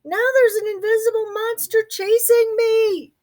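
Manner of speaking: high, squeaky panic; panicked mouse voice. Acted. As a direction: squeal it while fleeing.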